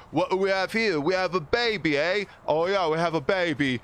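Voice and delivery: hammed-up street thug; gang leader. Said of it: "the guy goes".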